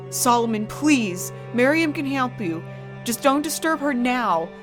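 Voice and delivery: high, loud, desperate plea; pleading scream. Acted. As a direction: scream it, begging him to stop. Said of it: "Mother's voice rose to a scream".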